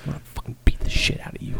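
a hushed, menacing joke threat, barely voiced; mock whispered threat. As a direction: whisper the threat like a movie tough guy.

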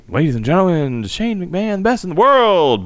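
high booming ring announcer; boxing ring announcer. Pretend. Ring introduction of Shane.

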